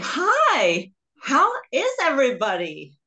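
a child's eager fake American hello; put-on American accent. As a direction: sound like the American kids to fit in. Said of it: "you don't sound like us".

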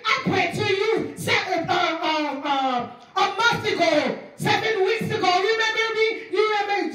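high, hammed-up insistent begging; desperate pleading. Forgotten believer to Jesus at the door, desperately pleading. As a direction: grasp at proof that you should be known.

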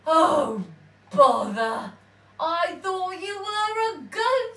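a hint of a loud grumbling troll; grumpy troll. A narrator's reading. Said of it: "said the troll".

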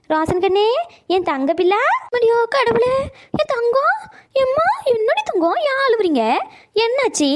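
high, drawn-out doting coo; fussing over a child. Acted. Grandmother to crying grandson, high fussing coo.

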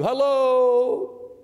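Jesus calling to the deaf one from behind. Retold or imitated. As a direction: call out to get his attention. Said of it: "He cr-, yells at you".

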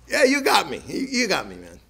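mock surrender. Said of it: "I know what I'd say".

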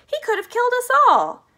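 very high, loud screech of protest; outraged scream. A narrator's reading. Grandma Josephine accuses Wonka, outraged scream. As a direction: scream it, indignant and frightened. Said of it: "screamed Grandma Josephine".

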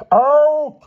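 high, very loud furious shout; explosive bellowed order; thundering. A narrator's reading. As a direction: thunder it at the top of your voice. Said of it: "thundered Mister Sturgeon".

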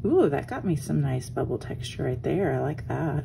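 ordinary talk, nothing marked

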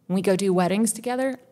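normal talking voice

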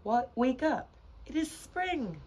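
high, loud wake-up call; excited storybook shout. A narrator's reading. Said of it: "shouted Frog".

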